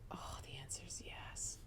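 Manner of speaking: slow, breathy whisper; soft whispered inner voice; little inner voice. Retold or imitated. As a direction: whisper it like a secret truth. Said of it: "that little voice was".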